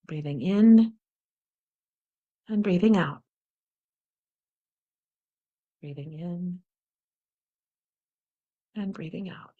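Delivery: soft, slow guided breathing; slow calm breathing cue. Shown as a demonstration. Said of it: "breathe into our heart space".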